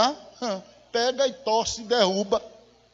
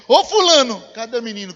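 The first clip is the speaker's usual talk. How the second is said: imitated calling out; 8 dB louder than usual. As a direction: call out loudly, pitched up. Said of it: "levanta o lençol e diz".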